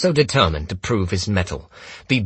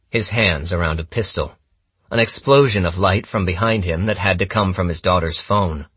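usual speaking voice

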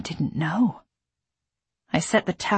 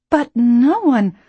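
loud protest; 6 semitones higher, 8 dB louder, slower than usual. A narrator's reading. Nurse objecting, loud.

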